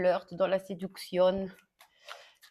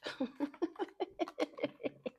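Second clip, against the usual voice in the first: amused laugh; 7 semitones higher, 8 dB quieter than usual. Real, not acted. Laughs at her own idea.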